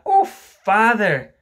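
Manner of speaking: loud, high, drawn-out "Oh, father"; exclaimed reproach. Acted. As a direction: cry out to father in dismay, stretching it. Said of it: "Wendy explained".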